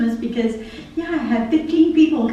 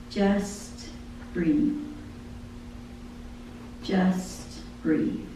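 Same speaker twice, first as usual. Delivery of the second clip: soft, much quieter, lower; softly breathed out; hushed; 3 semitones lower, 10 dB quieter, much slower than usual. Acted. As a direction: hush it like a gentle calling. Soft voice of God in lyric.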